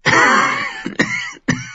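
weak, high coughing fit. A narrator's reading. Dying maester breaks off talking to cough, feebly. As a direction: cough in broken bursts. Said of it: "Er hustete".

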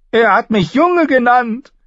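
high, loud whiny complaint; sulky boy king. A narrator's reading. Joffrey sulking to the council, high and whiny. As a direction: whine like a spoiled child. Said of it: "klagte Joffrey übellaunig und klang dabei jünger".

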